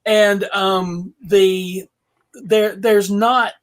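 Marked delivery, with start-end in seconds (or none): none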